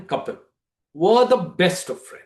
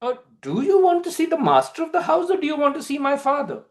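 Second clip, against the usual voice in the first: higher, fast, sharp questioning; haranguing girl; 9 semitones higher, faster than usual. A narrator's reading. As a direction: throw his words back at him, quick and high. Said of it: "As this haranguing carries on".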